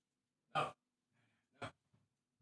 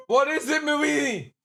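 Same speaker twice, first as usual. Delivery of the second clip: very high pet voice; talking to the dogs; 14 semitones higher, 6 dB louder than usual. Real, not acted. Owner asking his huskies what they're saying, high pet voice. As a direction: call up to the dogs sweetly.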